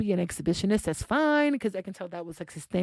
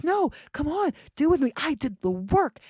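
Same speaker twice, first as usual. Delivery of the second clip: imitated pleading, starting high; insistent coaxing; 7 semitones higher than usual. Teacher to his reluctant date, insistent coaxing. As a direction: coax eagerly like a man who won't take no.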